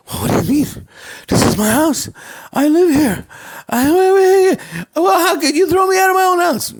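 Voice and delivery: high, loud, disbelieving yelling; outraged protest. Acted. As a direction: shout he owns the house, close to tears. Rich man thrown out protests.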